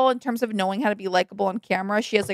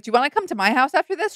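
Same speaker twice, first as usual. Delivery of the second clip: quick mock invite; 5 semitones higher, faster than usual. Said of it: "when Shep's like".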